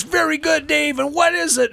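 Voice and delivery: very high acted quizmaster reply; hammed-up praise. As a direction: imitate a delighted leader testing a pupil. Leader praising boy before the group.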